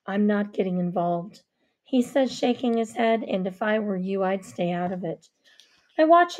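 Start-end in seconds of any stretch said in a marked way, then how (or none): 0.1-1.4 low male voice. Acted. Avery speaks. 3.2-5.3 low male voice. Acted. Avery speaks.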